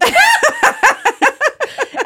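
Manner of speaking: loud high laugh. Real, not acted. Friends joking about thrusting, loud high laugh. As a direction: let out a big shriek of laughter.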